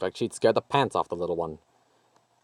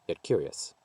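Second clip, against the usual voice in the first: plain reading voice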